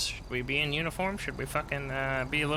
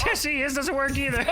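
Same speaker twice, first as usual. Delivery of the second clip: high pitched laughing voice; laughing through voice changer; 10 semitones higher, 4 dB louder than usual. Real, not acted.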